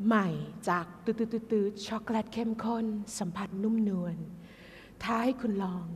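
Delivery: hushed, alluring product pitch; seductive chocolate-ad voice. Acted. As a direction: purr it like a luxury chocolate advert. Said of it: "เสียงมันจะแบบ".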